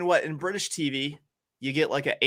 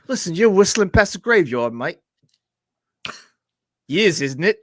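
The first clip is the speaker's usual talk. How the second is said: loud, higher-pitched bad British accent; terrible British accent; 6 semitones higher, 7 dB louder than usual. Playing a sad old British man with wisdom, terrible British accent. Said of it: "That's my terrible British accent".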